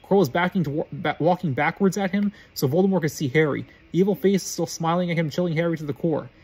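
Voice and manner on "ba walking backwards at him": usual speaking voice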